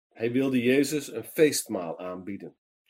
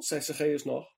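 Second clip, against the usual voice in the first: ordinary delivery, nothing marked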